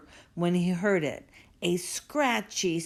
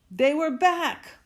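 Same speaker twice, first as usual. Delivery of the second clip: gasp, then a high, louder startled line; gasping fright; 8 semitones higher, 5 dB louder than usual. Acted. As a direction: gasp in mock horror at the discovery. Gasp on finding underwear.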